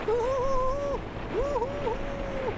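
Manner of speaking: high, sing-song woo-hoo-hoo; playful whooping. Real, not acted. Vlogger hooting at the view in the wind, high and gleeful.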